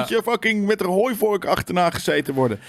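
high, whiny imitation of an outraged fan; mocking the fans who are angry about broken promises; mock angry gamer. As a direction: mimic a petulant entitled gamer. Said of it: "Ik ben niet zo van".

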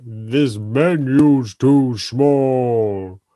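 slow, deep lightly voiced giant; a hint of a giant, drawn out; Green Giant impression. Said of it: "a horrible, uh, giant impression".

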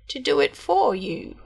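lightly voiced crafty little man; sly hobgoblin. A narrator's reading. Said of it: "said the hobgoblin".

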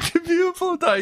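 very high squeaky squeal. Acted. Quoted line, very high.

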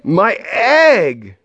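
high, alarmed cry; startled storybook shout; Horton shouting. A narrator's reading. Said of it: "shouted Horton".

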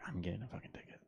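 very soft, low aside to yourself; retold thought, barely voiced; inner voice. Driver pulled over thinking of the ticket, hushed inner voice. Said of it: "I literally in my head".

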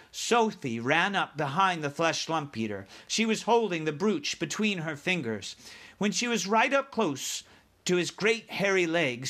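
ordinary delivery

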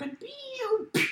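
high whistling pew pew; fireworks noise. Shown as a demonstration. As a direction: mimic a firework shooting off. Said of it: "my stupid impression of a firework".

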